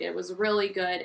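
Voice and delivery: ordinary talk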